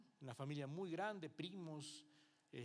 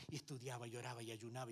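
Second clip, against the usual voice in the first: ordinary talk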